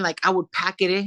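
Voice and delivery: normal talking voice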